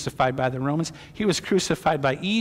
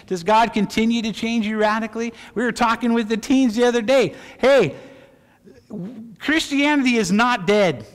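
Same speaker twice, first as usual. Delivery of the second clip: raised, louder sermon voice; emphatic, high-pitched exhortation; fervent preaching; 4 semitones higher, 4 dB louder than usual. Real, not acted. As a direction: challenge the congregation with energy. Preaches at full force.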